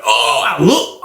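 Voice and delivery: usual speaking voice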